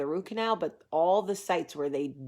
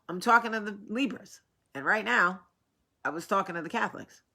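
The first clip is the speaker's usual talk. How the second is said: usual speaking voice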